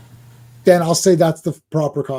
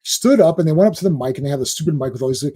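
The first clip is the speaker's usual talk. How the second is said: ordinary talk, nothing marked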